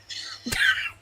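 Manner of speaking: shrill screeching wyvern cry; pterodactyl screech. Pretend. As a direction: let out a piercing prehistoric bird shriek. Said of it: "You gotta make your pterodactyl sound".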